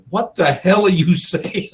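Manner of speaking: loud, high, baffled what-the-hell, breaking into a laugh; half-laughing disbelief at the thick accent; baffled outburst. Retold or imitated. Host retelling his confusion, loud and laughing. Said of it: "I was like".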